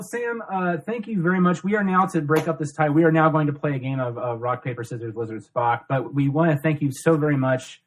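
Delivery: ordinary talk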